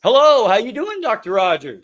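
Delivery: high, loud, delighted hello; excited greeting. Real, not acted. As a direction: greet the guest with big energy. Host greets guest as call connects.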